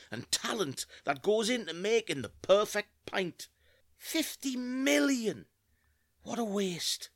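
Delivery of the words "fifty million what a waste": slow, high, drawn-out lament. Acted. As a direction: draw it out in dismay. Lamenting the wasted pints.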